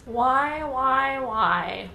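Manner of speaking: mock the film villain's wail, lightly voiced; noseless Voldemort. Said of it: "this is how he would accurately sound".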